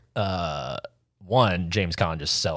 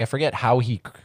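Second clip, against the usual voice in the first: usual speaking voice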